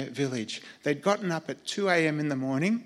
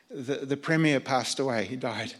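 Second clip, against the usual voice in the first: normal talking voice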